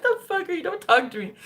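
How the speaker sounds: mock outrage. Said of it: "I remember I was like".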